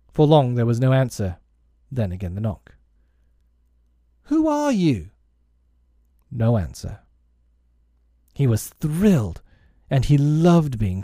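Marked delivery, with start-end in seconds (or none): 4.2-5.1 put-on very high, boyish demand; insistent challenge. A narrator's reading. Peter demanding the knocker's name, high insistent call. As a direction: pitch it right up, falling at the end.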